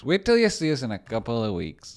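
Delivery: loud, high mock boast; hammed-up boast. Streamer boasting about weeks to come, hammed up loud. Repeats catchphrase three different ways.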